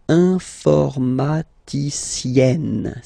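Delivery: informaticienne drawn out very slowly, every syllable and final enne stressed; exaggerated pronunciation. Shown as a demonstration. Teacher over-articulating informaticienne so students hear the ending. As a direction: spell informaticienne out in sound, syllable by syllable. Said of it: "I tend to insist a little bit".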